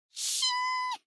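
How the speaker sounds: very high, thin metallic ring; sword-drawing sound. Acted. Granny draws her sword, high ringing "shing". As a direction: imitate a blade sliding out.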